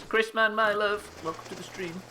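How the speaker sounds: high, loud affectionate welcome; gushing high-pitched hello, easing off at the end; effusive greeting. Real, not acted. Effusive greeting to arriving viewer.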